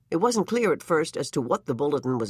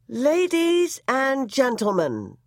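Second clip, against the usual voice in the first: ballerina reading bulletin; 10 semitones higher, much slower than usual. A narrator's reading. Ballerina reading the news bulletin, melodic and slow. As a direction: read it out as a lovely stately melody. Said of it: "a warm, luminous, timeless melody".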